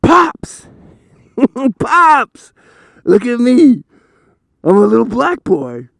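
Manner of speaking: put-on high, loud kid calling out; excited little boy. Son calling his dad to look, high loud kid voice. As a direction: call out like an eager child.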